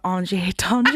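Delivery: "Angier Tangier" said French-style, "on-zhee-ay tawn-zhee-ay"; "Angier Tangier" drawn into faux-French; Frenchified mispronunciation. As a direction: rhyme "Angier Tangier" as mock French, both ending "ay". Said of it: "I used to call it".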